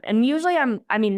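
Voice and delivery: ordinary talk, nothing marked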